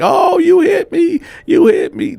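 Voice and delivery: mock wail of the mother after the elbow; dramatic mother. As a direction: overplay the victim on the floor. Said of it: "being dramatic".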